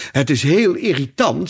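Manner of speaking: put-on fretful, annoyed girl; exasperated Alice. A narrator's reading. Alice frustrated with the cake.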